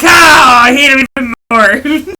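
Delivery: loud, slow, whiny outraged cry; mock outraged shout. Wife answering husband about wrestling, mock outraged shout. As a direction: wail it like a wronged fan. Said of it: "And I'm like".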